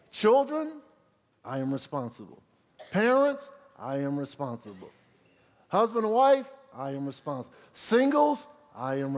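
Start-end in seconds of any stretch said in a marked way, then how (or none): 0.1-0.8 put-on loud, high call to the children; a preacher's rallying call. Calling out each group. 2.9-3.5 put-on loud, high call to the children; a preacher's rallying call. Calling out each group. 5.7-6.5 put-on loud, high call to the children; a preacher's rallying call. Calling out each group. 7.7-8.5 put-on loud, high call to the children; a preacher's rallying call. Calling out each group.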